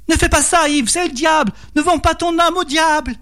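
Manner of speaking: high-pitched alarmed wife; crying out. A narrator's reading. Wife warning husband against the devil, high-pitched cry. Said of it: "Marie s'approcha en criant".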